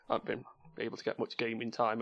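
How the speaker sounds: ordinary delivery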